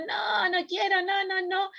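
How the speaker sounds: put-on high, shouted childish no, no; child's tantrum shout. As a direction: yell it like a toddler refusing. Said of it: "cuando yo estoy gritando".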